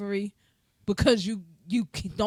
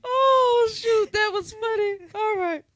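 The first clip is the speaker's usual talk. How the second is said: normal talking voice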